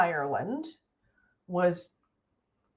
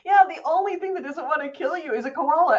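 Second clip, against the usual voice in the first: usual speaking voice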